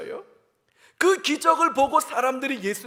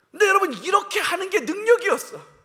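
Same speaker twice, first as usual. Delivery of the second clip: ordinary delivery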